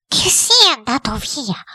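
high, slow wondering question; worried headmistress. A narrator's reading. Headmistress asking the cook if spirit left, high and slow. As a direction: wonder aloud in a high woman's voice.

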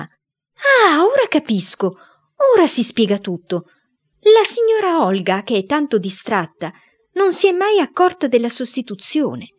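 esclamazioni più acute e forti; grido indignato. A narrator's reading. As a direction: sfogati come una madre scoperta. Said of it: "gridava la mamma". (la mamma capisce tutto e grida)

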